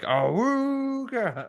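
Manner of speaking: high, slow mock klaxon "awooga"; alarm imitation. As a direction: hoot it like an old car horn. Mimicking alarm sound.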